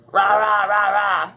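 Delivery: loud string of rahs; monster language. A narrator's reading. Monster answering Bubbles tearfully, roaring in monster language. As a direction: answer in short roars. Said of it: "the monster answered back".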